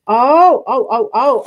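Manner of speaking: gasping, loud, thrilled oh oh oh; excited gasp. Real, not acted. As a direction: suddenly get it and burst out. Discovers how dragon sits.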